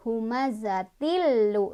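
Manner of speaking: humazatil with the lu held long and pressed. Shown as a demonstration. Teacher showing students how to press the lu, slowly. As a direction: lean on lu in Humazatil lu. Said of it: "ditekan aja".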